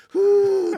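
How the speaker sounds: loud high exaggerated phew; loud sigh of relief. Alone after the teens leave, loud relieved phew. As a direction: blow out a big relieved phew aloud. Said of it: "はっきり声に出して".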